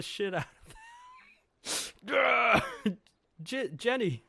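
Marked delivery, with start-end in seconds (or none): 1.6-2.9 loud, high shrieking war cry; piercing high battle shriek. Acted. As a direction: scream while charging in. Jenny's battle yell, acted.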